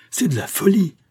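high, loud outraged cry; spluttering anger. A narrator's reading. Marquis rejects his son's marriage as madness, furious. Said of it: "bégayant de colère".

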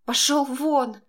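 sharp high hysterical cry; a shrill burst of panic; hysterical shriek. A narrator's reading. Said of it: "завизжала истерично".

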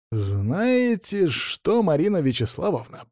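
slow, drawn-out mock menace; growled. A narrator's reading. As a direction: stretch the words in restrained anger. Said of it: "прорычал я".

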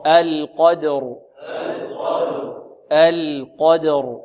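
القدر drawn out slowly, heavy qaf, light dal, heavy ra; tajweed recitation. Shown as a demonstration. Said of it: "القاف مفخمة والدال مرققة والراء مفخمة". (تلاوة القدر ببطء)

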